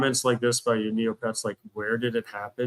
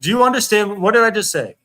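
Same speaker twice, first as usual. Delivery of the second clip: high, loud exasperated yell; exasperated shout; 8 semitones higher, 8 dB louder than usual. Real, not acted. Streamer yelling at his chat, exasperated shout.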